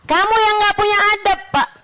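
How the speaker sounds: heated retort, pitch up. Retold or imitated. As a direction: throw the words back at him, heated. Retort repeated, raised back.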